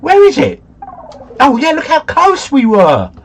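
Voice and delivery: high, loud exclamation of triumph; excited outburst. Real, not acted. Player seeing how close the guess landed, excited. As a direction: call it out high and loud, thrilled.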